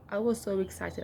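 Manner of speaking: ordinary talk, nothing marked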